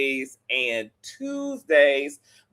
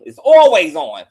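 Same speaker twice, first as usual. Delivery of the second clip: loud emphasis; 10 dB louder than usual. Real, not acted. Repeats for emphasis.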